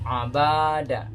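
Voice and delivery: "abada" slow, with the middle syllable "ba" wrongly drawn out long; wrong reading. Shown as a demonstration. Showing students the wrong long "ba" in "abada". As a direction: drag the "ba" in "abada" out on purpose. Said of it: "banyak kita tadi panjangkan".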